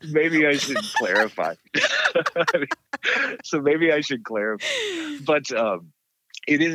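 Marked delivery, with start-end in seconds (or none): 0.1-4.6 laughing. Real, not acted. Laughing while backtracking.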